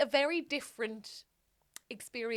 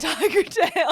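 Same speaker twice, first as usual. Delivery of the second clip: usual speaking voice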